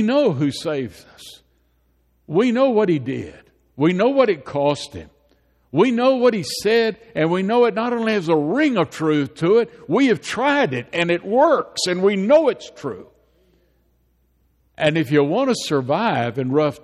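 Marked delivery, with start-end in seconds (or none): 3.8-13.1 preacher in full swing, pitch up; fervent preaching. Acted. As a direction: drive the point home to the congregation. Pastor preaching on faith.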